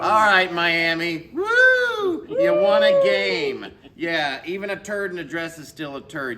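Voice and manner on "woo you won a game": high, loud, drawn-out mock celebration; mock cheering. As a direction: whoop it up sarcastically for a losing team. Host mockingly cheers a poor team.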